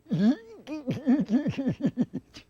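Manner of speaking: high, long warbling puppet laugh; goofy dummy laugh. Acted. Dummy caught out over own name, high goofy laugh. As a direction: let out a pulsing silly giggle.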